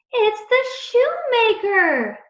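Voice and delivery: high, loud and drawn-out, bursting with recognition; excited cry. A narrator's reading. Alexei recognizes the priest in church, excited cry. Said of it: "Alexei cried".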